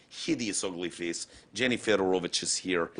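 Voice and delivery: put-on low, soft Russian accent; heavy-vowelled Russian English, kept quiet. Host greets a Russian producer, low Russian accent. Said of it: "That's the greatest Russian accent I've ever heard".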